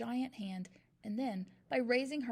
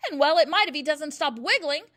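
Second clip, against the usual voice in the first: much louder scornful snort; 5 semitones higher, 14 dB louder than usual. A narrator's reading. Headmistress scorning the teacher's plea, snorting. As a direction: snort it back at her. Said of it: "snorted the Trunchbull".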